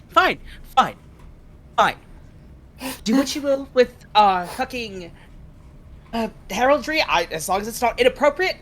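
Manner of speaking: ordinary talk, nothing marked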